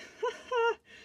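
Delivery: sudden high burst of laughter. Real, not acted. Reader laughs at the oracle card she pulled, high laugh. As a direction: break into a quick high laugh. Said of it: "I laugh because of the card".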